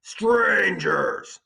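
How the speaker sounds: booming, high, loud giant's challenge; Cyclops voice. Acted. Cyclops confronting the strangers in his cave, booming voice. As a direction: bellow it like a suspicious giant. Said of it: "he said".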